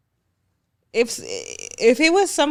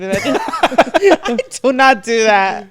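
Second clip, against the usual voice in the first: laughing denial; 4 dB louder, much slower than usual. Real, not acted.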